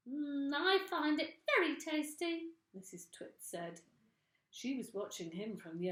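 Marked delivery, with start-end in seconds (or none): 0.1-2.6 high, falsely sweet voice; sugary old woman. Acted. Missus Twit sweet-talking her husband into eating. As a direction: coax him sweetly.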